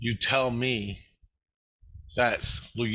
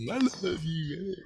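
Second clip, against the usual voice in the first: mock lovesick stalker; 8 semitones higher than usual. Voicing the obsessed stalker.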